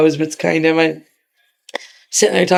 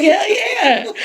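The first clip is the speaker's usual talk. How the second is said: cocky cheer; 13 semitones higher than usual. Retold or imitated. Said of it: "I was like".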